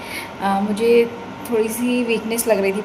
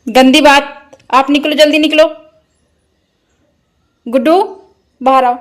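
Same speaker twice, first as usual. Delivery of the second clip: loud, raised-pitch motherly scolding; 4 semitones higher, 8 dB louder than usual. Real, not acted. Mother telling her toddler to get out quickly, loud scolding.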